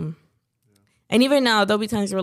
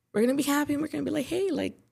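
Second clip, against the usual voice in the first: forced cheerfulness; 6 semitones higher, faster than usual. Retold or imitated. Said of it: "I'd just breathe and be like".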